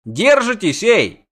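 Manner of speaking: high, loud cry from above; shouted call. A narrator's reading. Bard calls down to the hanging pair, shouted call. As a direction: holler down to hang on. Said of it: "крикнул сверху Лютик".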